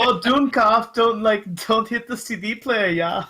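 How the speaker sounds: Euro trash German. Retold or imitated. Quoting a Simpsons Euro trash German, in a mock German accent. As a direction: parody a Simpsons German thief. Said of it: "a Euro trash German".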